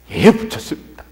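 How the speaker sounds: brisk obedient answer at full voice; dutiful reply. Acted. Junior pastor reporting to senior pastor, dutifully compliant. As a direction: report it smartly to your superior. Same daily answer, repeated.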